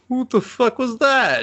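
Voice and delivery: high, shrill disbelief; mock outrage. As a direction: squeal it in shock. Jealous knight reacts.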